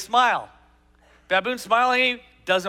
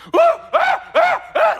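high hooting jeer, loud and mocking; teenage guys teasing monkeys; 8 dB louder, much slower than usual. Teenage guys taunting zoo monkeys, high mocking hoots. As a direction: hoot at the apes like a teasing teenager. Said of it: "They're like".

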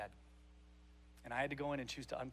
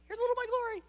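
Jesus revealing glory; 10 dB louder, much faster than usual. Acted. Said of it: "Jesus just cracked the door and said".

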